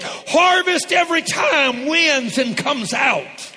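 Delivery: slow, high, full-throated shout; preaching shout. Real, not acted. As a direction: proclaim it at full force.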